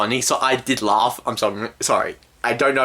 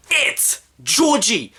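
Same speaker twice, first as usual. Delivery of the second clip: high, drawn-out exclamation; outraged recognition; 9 semitones higher, much slower than usual. Real, not acted. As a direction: call out the rip-off, stretching each word. Realises the film copies It.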